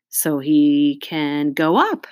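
slow, drawn-out words; slow explanation. A narrator's reading. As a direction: spell it out slowly. Classmate explains push down, drawn out.

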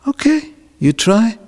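high, loud coaching voice; urging mother. Acted. Mother urging son to try.

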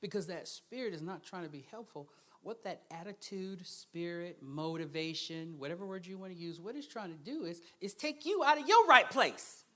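ordinary delivery

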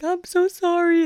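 a daughter calls her parents after wrecking two tires, half crying; tearful apology. Retold or imitated. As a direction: plead sorry to parents, wound up and teary. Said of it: "I started crying".

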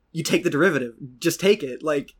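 mock a tutor losing patience, breaking into a laugh; exasperated tutor. She was just like this explaining derivatives, exasperated.

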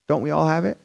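ordinary talk, nothing marked

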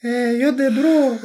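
high, mock-earnest classmate voice; light impression of a school friend; imitating the classmate. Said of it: "jag måste göra hans röst då".

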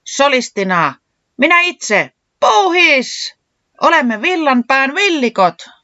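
high, loud band announcement howled out; howling shout. A narrator's reading. Puuhis introduces himself and the band, howling. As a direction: howl it like a dog frontman. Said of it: "Puuhis ulvoi niin".

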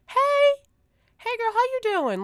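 put-on very high, loud sing-song hey girl; excited greeting. Truck driver gushing hello to a neighbour, high and loud. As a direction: gush like an old friend spotting you.

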